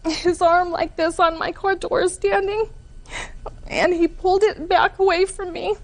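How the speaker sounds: high, tearful voice, close to crying; upset, high-pitched retelling with halting breaks; real tearful account. Real, not acted. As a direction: testify through tears, pausing between phrases. Tearfully recounts being hurt by him.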